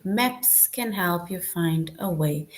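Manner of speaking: slow, even statement voice; teacher modelling a statement slowly; slow statement reading. Shown as a demonstration. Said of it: "That is a statement that someone makes".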